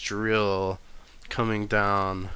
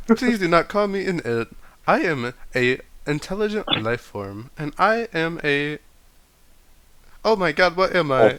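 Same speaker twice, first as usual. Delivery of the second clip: high, loud offended robot; 8 semitones higher, 6 dB louder than usual. Acted. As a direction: protest like an indignant robot, then panic. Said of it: "robot voice continues".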